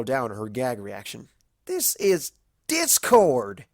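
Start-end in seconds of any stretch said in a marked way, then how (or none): 1.7-3.7 louder, higher, slow protest on THIS; outraged cry. A narrator's reading. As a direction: spell out the horror. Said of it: "Applejack screeched".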